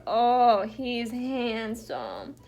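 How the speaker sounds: very drawn-out, slow cooing; gushing pet talk. Pretend. Vlogger admiring her pet's pretend makeover, slow gushing coo. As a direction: gush slowly over a pet, stretching the words.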